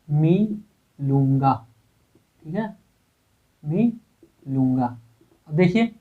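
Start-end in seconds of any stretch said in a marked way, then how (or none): 0.1-1.5 मिलूंगा drawn out very slowly; slow dictation. Shown as a demonstration. Teacher dictating the sentence to students, very slow. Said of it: "अशुद्ध वाक्य". 3.6-4.9 मिलूंगा drawn out very slowly; slow dictation. Shown as a demonstration. Teacher dictating the sentence to students, very slow. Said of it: "अशुद्ध वाक्य".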